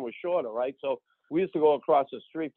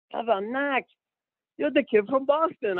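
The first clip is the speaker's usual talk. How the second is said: imitated amazement, pitched very high; astonished recognition; 11 semitones higher than usual. Retired star meeting the rookie at lunch, astonished. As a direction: exclaim it high, as a mock-amazed player.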